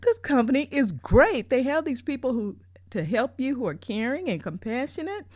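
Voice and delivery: high, loud, delighted gushing. Retold or imitated. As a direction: rave like he's found paradise. Gushing about the caring staff.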